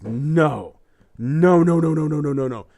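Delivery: loud, pleading string of no; the patient refuses the verdict over and over; despairing no. Acted. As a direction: beg against the verdict. Joke character despairs.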